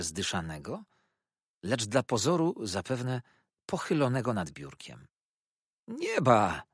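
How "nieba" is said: higher-pitched exclamation; excited cry. A narrator's reading. Treadles spots his friend at the door, excited cry. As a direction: cry out in mild astonishment. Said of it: "zawołał".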